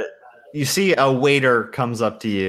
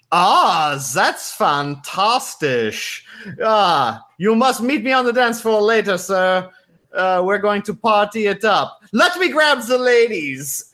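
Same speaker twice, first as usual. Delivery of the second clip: higher, lively smooth-talking charmer voice; suave party guy; 9 semitones higher than usual. Acted. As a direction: schmooze them like a flashy playboy. GM voices suave suit-wearing guy.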